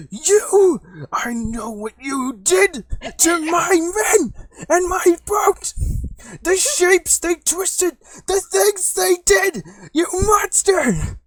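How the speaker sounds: high, slow, full-force hysterical screaming; freaking out. Acted. Prisoner blaming captors for his men and boat, hysterical screaming. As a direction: scream each accusation, stretched out and frantic. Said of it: "he starts freaking the fuck out".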